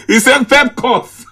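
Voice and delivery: words squeezed out through a real laugh; amused laugh breaking over the words. Real, not acted. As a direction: chuckle right through it. Host laughs at caller's titles.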